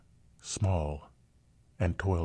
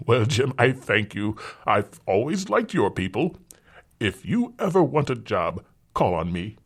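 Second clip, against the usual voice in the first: louder, higher condescending gent; patronizing father; 5 semitones higher, 6 dB louder than usual. Acted. Rich father tips the rescuer, louder patronizing gent. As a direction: talk down kindly to a servant.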